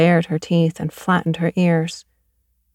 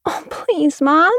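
put-on higher pleading child; begging girl; 10 semitones higher, slower than usual. Girl begging her mother again, higher pleading. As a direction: beg mom, a little whiny. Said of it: "Sheila begged".

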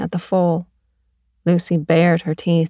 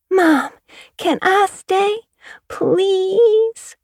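put-on much higher, slow pleading child; begging girl; 13 semitones higher, much slower than usual. Girl begging her mother to stay the night, high pleading. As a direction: plead with mom, drawn out.

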